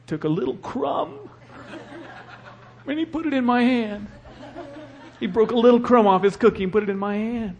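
high, amused and chuckling as he tells it; laughing in disbelief. Real, not acted. Pastor laughing as he tells of his son's crumb. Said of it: "I was just dumbfounded".

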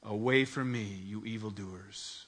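low, slow, hushed pronouncement; grave divine rejection. Acted. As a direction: pronounce judgment slowly, dropping soft. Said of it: "those horrible, terrifying words".

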